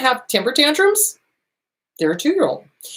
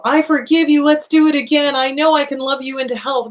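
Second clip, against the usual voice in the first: higher, louder gushing pushover; mock naive forgiveness; 6 semitones higher, 4 dB louder than usual. Host mocking a victim who clings to the abuser, gushing mock sweetness. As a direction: gush like someone sure love will fix them.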